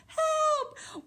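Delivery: very high, loud storybook scream; shrieked cry for help. Acted. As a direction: scream for help as a frightened Goldilocks. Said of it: "be Goldilocks and scream".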